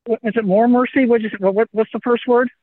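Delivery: usual speaking voice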